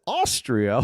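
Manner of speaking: lightly acted questioning echo, fuller voice; man's mistaken echo. Host retelling the man's echo in Dumb and Dumber, questioning. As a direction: echo it back as the clueless film character. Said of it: "And then he says".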